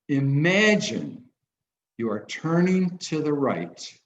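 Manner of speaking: slow, measured guiding voice; slow guided visualization read; guided imagery. Acted. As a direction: lead them into it step by step, unhurried. Leads guided imagination exercise.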